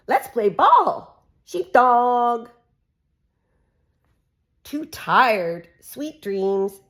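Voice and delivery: lively sing-song picture-book reading; reading to children. Acted. Mum reading a farm book to her kids, animated. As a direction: read it out brightly for little ones.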